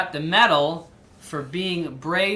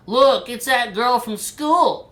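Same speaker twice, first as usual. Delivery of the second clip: put-on slow, taunting kid voice, higher and louder; mocking twin; 6 semitones higher, 6 dB louder, slower than usual. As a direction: sneer like a school bully. Twins mocking Violet.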